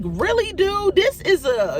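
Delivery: high-pitched, indignant disbelief; retold incredulity. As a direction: call out someone's indifference, pitch jumping up. Retold reaction to uncaring officer.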